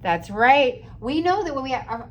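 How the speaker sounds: ordinary talk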